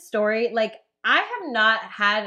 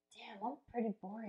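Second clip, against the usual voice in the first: much softer self-deprecating aside; muttered to self; 3 semitones lower, 20 dB quieter than usual. Retold or imitated. Friend comparing her quiet life, softer and rueful. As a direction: say it under your breath to yourself. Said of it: "I'm, like, listening to this".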